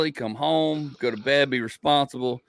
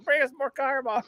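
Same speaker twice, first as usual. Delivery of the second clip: high strained mock call; imitated drunken demand; 13 semitones higher than usual. Drinker kept asking for more car bombs, mock pleading. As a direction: mimic a drinker calling for more.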